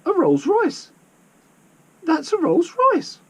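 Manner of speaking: very high, loud excited squeak. A narrator's reading. Lizzie sees the rental car is a Rolls Royce, squeaking. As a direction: squeak it as high as your voice goes. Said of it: "in probably the highest voice she'd ever spoken in".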